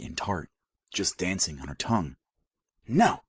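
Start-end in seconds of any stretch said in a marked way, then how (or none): 2.9-3.2 high, louder desperate cry; gasped no. A narrator's reading. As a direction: fight for control as it bursts out. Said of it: "she gasped".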